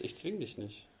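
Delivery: dropped to a soft, almost private aside. Real, not acted. Preacher telling the sinner gently he won't force him, softly. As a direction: let it fall away softly.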